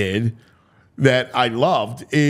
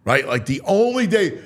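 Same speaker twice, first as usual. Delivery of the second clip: usual speaking voice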